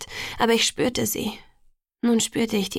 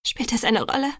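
mildly tearful question; holding back tears; 2 semitones higher than usual. A narrator's reading. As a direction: ask with a touch of choked-back tears. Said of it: "das war mir anzuhören". (mit leicht unterdrückten Tränen)

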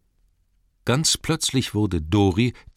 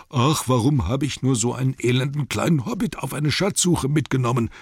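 higher, agitated moaning character voice; complaining dwarf; 6 semitones higher than usual. A narrator's reading. Exhausted dwarf carrying a hobbit, moaning in complaint.

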